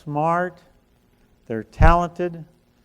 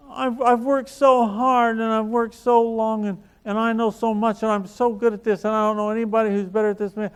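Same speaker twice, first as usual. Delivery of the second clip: higher, whiny mock of her tearful complaint; upset friend; 5 semitones higher, 3 dB louder than usual. Coach mimics crying friend defending herself, higher whiny voice. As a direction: play her self-pity back, pitched up. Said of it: "She said".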